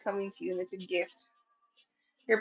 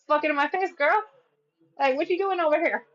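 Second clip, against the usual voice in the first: higher-pitched playful telling-off; mock sassy brush-off; 6 semitones higher, 3 dB louder than usual. Listener telling returning ex to get lost, mock indignant. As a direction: act out shooing an ex away.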